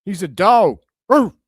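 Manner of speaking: loud, pumped-up cheer; hyped yell. Real, not acted. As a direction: shout it out like a fan on his feet. Still hyped after the dunk.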